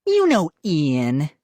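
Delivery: slow, drawn-out sneer. A narrator's reading. Sister mocking brother to mom, sneering. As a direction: drag it out with contempt. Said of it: "Molly said, sneering".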